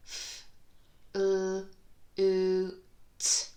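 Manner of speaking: F, l, oo, t each said alone with a loud oo; sound-by-sound. Shown as a demonstration. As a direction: tap out each sound on a finger. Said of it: "So four sounds in that word".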